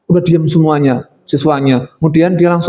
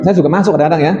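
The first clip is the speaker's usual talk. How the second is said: ordinary delivery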